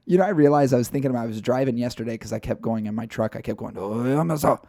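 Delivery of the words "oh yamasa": Yamasa with a put-on Japanese lilt; mock Japanese accent. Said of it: "definitively Japanese if you make it sound like that".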